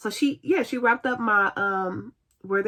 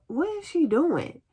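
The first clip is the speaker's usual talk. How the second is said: high-pitched "what on earth" disbelief; incredulous reaction; 7 semitones higher than usual. Retold or imitated. Patient watching nurse touch things without gloves, incredulous. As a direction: pitch it up, baffled by what you see.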